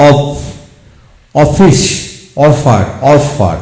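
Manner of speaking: অফার stretched out slowly with one ফ; demo pronunciation. Shown as a demonstration. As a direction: model অফিস and অফার slowly for learners. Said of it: "এভাবে হবে". (অফার শব্দটি ধীরে ধীরে, এক ফ উচ্চারণে)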